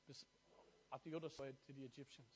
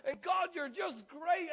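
much higher, loud cry of praise; exultant shouted praise; joyful shout; 13 semitones higher, 13 dB louder, slower than usual. Real, not acted. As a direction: shout it to the rafters.

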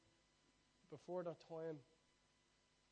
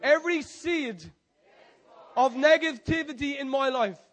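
fervent prayer shout; 11 semitones higher, 20 dB louder than usual. Acted. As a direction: shout the prayer high and loud. Call and response prayer.

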